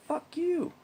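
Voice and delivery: very high-pitched mock retort; cheeky defiance. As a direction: toss it out in a squeaky high voice.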